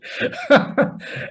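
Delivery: high unvoiced giggle; high breathy laugh. Real, not acted. Host laughing at the end of the Simon story, high breathy laugh. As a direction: let a silent wheezy laugh escape.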